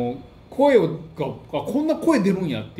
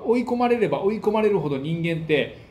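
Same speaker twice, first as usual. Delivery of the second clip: normal talking voice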